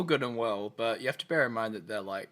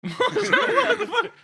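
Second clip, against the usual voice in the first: high, drawn-out laughing squeal; laughing disbelief; 7 dB louder, much slower than usual. Real, not acted. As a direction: squeal it slowly through real laughter. Cracking up at the botched Yoda voice.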